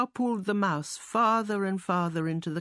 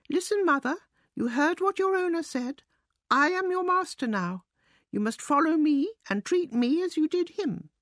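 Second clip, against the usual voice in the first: high-pitched mouse giving orders; bossy mouse; 8 semitones higher than usual. A narrator's reading. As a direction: take command in a high little mouse voice.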